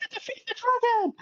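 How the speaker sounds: put-on high, excited kid's battle cry; young Milton playing adventurers; child at play. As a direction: shout like a kid playing hero. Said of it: "playing adventurers".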